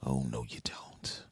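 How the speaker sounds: slow, hushed threat; whisper. Acted. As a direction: whisper it like a warning to the bugs.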